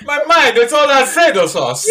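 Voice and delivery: high, loud, laughing, slowed; joking her brain is mush, laughing; laughing joke. Real, not acted. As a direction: giggle through the silly line. Joking about vacation brain.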